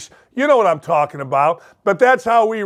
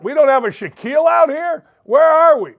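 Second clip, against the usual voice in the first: mock disbelief; 7 semitones higher than usual. Real, not acted. Mock outrage about Polish players.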